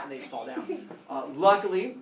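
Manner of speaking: ordinary talk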